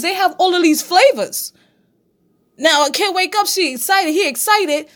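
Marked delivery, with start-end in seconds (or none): none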